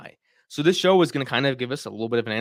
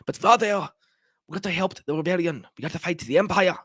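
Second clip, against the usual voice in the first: a light, pitched-up mock French accent; half-hearted French accent impression; imitating French accent; 5 semitones higher than usual. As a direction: play a young girl pleading, with a faint French accent. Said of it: "Hera had the French accent".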